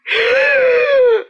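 loud high shocked gasp. Pretend. Teacher gasping loudly at the pupil's rude song. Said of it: "That's inappropriate!".

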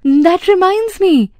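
sudden high-pitched realisation; exclaiming. A narrator's reading. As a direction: exclaim it as if just remembering. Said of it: "he exclaimed".

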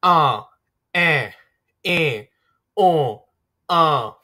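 "A, e, o, a" sounded through the nose; nasal vowels with air from nose; plain versus nasal vowels. Shown as a demonstration. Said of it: "Nasalized a, e, and o".

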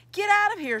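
high, loud yell at an intruder. Acted. Girl yelling at boy in girls' bathroom, loud shout. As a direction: shout him out of the room. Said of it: "she yelled".

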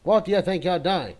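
angry shout. Pretend. Yells at enemy in game.

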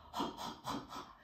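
"はっはっはっは" as pure breathy puffs, no vowel voice; teacher showing the h is only breath. Shown as a demonstration. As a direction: puff air for each "はっ", whisper-fast. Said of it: "実は、息だけの音が".

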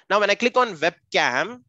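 ordinary talk, nothing marked

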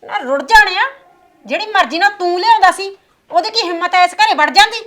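put-on mother yelling at her drunk son; angry shout. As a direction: shout him down in rage.

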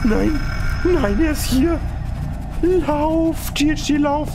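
high shrill panic yelling run; panicked scream. Real, not acted. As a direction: scream in terror as it appears. Monster appears, screaming. (panisch schreiend)

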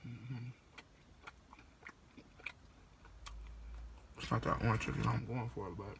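speaking between bites; talking while chewing. Real, not acted. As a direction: mouth partly full. Seen 3: Chewing visible before; mouth still working food while speaking in car.

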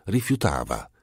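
ordinary delivery

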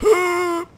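high startled gasp; sharp gasp. Real, not acted. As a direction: gasp in panic. About to be seen.

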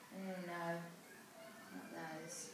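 plain reading voice

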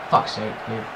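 ordinary talk

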